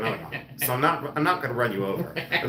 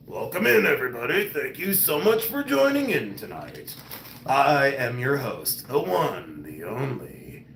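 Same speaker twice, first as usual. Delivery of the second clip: chest-voiced host. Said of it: "Let me Pall Mall Patty it".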